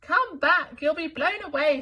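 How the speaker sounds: high, loud call; mock calling. Wilbur calling out to fool the elephants. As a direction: call out as a joke. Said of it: "said Wilbur".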